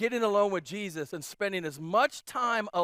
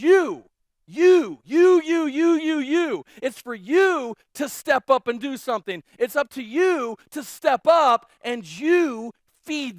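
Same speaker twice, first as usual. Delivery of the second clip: loud, higher, hammering each "you"; pointing you; 4 semitones higher, 8 dB louder, slower than usual. Retold or imitated. Jesus pointing at the disciples, loud and emphatic. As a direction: jab the word "you" each time. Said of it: "Jesus says".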